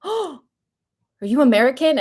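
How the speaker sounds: high, loud eager question; mock excited stranger. Runner imitating the stranger who stopped her, excited question. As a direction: burst out like a thrilled stranger.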